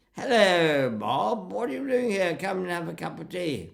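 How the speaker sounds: put-on high, loud well-educated boys' voice; cheerily patronising, swooping high; cheerful condescension. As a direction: hail him like a breezy upper-middle-class schoolboy. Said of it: "that cheerful condescension of well-educated, upper middle class boys".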